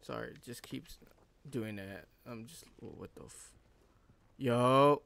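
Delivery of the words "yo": sudden loud startled yo. Real, not acted. Gamer startled by a figure appearing, loud yo. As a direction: jump and blurt it out.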